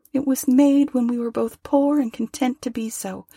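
put-on young girl, sorrowful. A narrator's reading. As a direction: lift into a tender girl's voice, close to tears. Young woman voiced in story.

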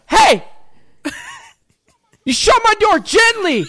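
high, loud hammed-up yell; mock furious shout. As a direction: bellow the order at the son. Yujiro yelling at Baki.

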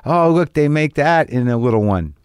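a mock-giddy oh at the little bottles; little thrill. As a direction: gush like a kid finding miniatures. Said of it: "some excitement I get when I'm like".

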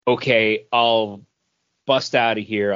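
ordinary delivery

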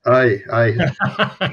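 jokey burst of broad Scottish; Scottish accent with a laugh. Shown as a demonstration. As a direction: play up the Scottish accent, amused. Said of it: "You've got it if you need it".

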